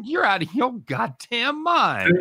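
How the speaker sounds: high, drawn-out retold rebuke; incredulous mock outrage. Host retells rejecting the points request, high and incredulous. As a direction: play up the slow, high disbelief. Acts out his reply to request.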